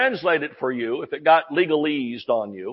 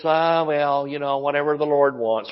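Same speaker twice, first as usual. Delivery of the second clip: mock false humility; 4 semitones lower, 4 dB quieter than usual. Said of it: "it's false humility".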